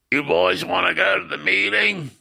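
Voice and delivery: high, sustained call to the cellmates; put-on voice of a woman inviting inmates along; Claire Madan. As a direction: imitate the visiting lady, pitched well up. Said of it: "she had a raspy heroin alcohol voice".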